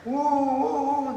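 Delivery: high, hooting "woo, woo, woo"; a playful hoot mimicking the rising argument; mock rising shouts. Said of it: "voices were getting higher and higher".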